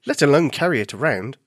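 ordinary delivery

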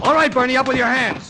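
loud high command to freeze; shouted arrest. Acted. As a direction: yell it as you charge in. Bursting in to arrest Bernie.

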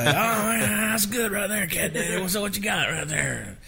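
high, excited producer impression; imitating Craig Wiseman. Songwriter imitates co-writer praising the melody, high-pitched impression. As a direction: push the voice up high, hyped on a find. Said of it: "I'm doing a Craig Wise imitation".

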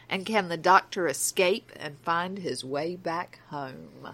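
episode blurb read with a light trailer lilt; podcast synopsis reading. Acted. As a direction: present the plot like a teaser.

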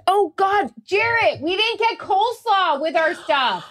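high, sudden dismay; dismayed realisation. Real, not acted. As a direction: it just hit you the coleslaw's missing. Sudden realisation coleslaw missing.

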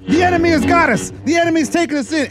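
high, loud mock panic; acted alarm. Ride crew warning of enemy capture, mock panic. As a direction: imitate a crew crying out under attack. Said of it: "They're like".

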